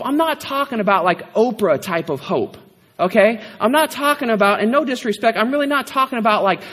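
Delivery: ordinary talk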